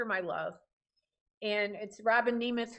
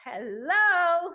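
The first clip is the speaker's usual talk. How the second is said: bright greeting; 7 semitones higher, 6 dB louder than usual. Real, not acted. Host answers caller brightly.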